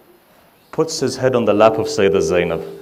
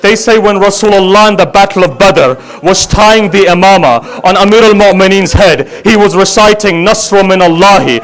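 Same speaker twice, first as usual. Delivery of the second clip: full-voiced grieving chant, louder and higher; loud lament recitation; 10 semitones higher, 12 dB louder than usual. Reciter mourning Ali Akbar's farewell to the gathering, loud wailing lament. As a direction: cry the story out to the mourners.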